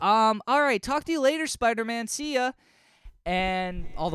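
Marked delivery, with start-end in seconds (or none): 0.0-2.5 high fake phone chatter. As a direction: pretend to talk on a dead phone. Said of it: "And Howard's like".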